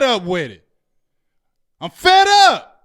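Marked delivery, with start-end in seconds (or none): 1.8-2.7 loud, high, fed-up outburst; fed up shout. Real, not acted. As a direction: blurt it out, sick of it all. Venting about team's losing.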